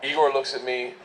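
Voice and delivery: ordinary talk, nothing marked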